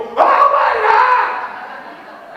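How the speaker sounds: very high, loud, drawn-out shout; mock horrified gasp of a parent; hammed-up shocked shout.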